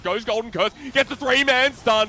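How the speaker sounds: loud, high, thrilled play-by-play call; excited shouting. Real, not acted. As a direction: yell the big teamfight as it unfolds. Teamfight climax in live cast.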